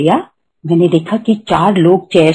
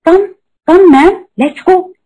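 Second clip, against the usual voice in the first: higher, gentle come ma'am; lovingly calling; 10 semitones higher, much slower than usual. Retold or imitated. Assistant calling her into surgery, higher loving call. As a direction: coax the patient along kindly, voice lifted. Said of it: "इतने प्यार से बोलते".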